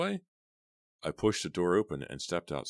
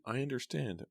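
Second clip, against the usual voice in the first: shaky voice; 9 semitones higher than usual. A narrator's reading. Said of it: "I said shakily".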